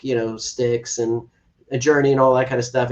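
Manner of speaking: ordinary talk, nothing marked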